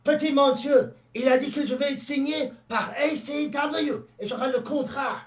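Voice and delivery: high, theatrical French patter; French carnival puppeteer. Acted. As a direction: play the puppeteer in excited, high-pitched French. Plays puppeteer speaking French.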